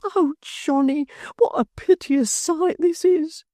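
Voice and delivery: a simple-hearted fellow crying over his ruined friend; tearful lament. A narrator's reading. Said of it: "cried outright and wrung his hands".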